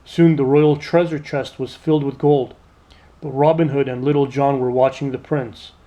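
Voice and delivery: plain reading voice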